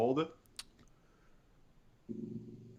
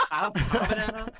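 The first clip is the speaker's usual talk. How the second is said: high, loud laughing repeat; 8 semitones higher, 5 dB louder than usual. Real, not acted. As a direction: laugh through the words. Laughing at the misread word.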